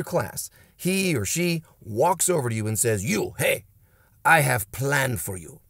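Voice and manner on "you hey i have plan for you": bad Russian accent. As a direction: coach with a hammed-up Russian accent. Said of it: "this coach has a Russian accent, a bad Russian accent".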